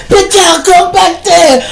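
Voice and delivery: put-on loud, high drunken shouting; going off. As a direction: rant and threaten at full volume. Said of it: "he just going off".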